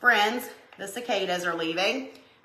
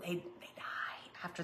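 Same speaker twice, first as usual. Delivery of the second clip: whisper; 3 semitones lower, 17 dB quieter than usual. Real, not acted. Whispered aside about cicadas.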